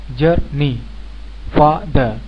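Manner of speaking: जर्नी and फादर each broken in two: जर-नी, फा-दर; two-syllable split. Shown as a demonstration. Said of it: "दो भागों में इनको उच्चारित करते हैं".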